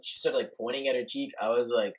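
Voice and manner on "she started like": ordinary delivery, nothing marked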